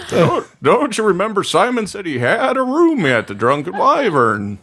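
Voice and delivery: high, whinnying horse voice. Acted. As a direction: ask it like a chatty horse. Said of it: "That's my horse voice".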